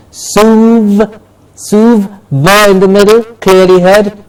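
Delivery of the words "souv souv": souv- held alone as "soov"; syllable demonstration. Shown as a demonstration. Teacher breaking souvenir down, isolated "soov". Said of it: "Please remember the pronunciation".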